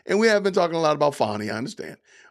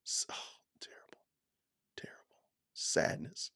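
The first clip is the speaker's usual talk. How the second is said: near-silent whispered fake grief; mock sorrow; 2 semitones higher, 16 dB quieter than usual. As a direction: whisper it as if too crushed to speak. Mock heartbreak over a chat comment.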